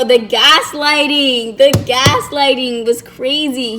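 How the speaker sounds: ordinary talk, nothing marked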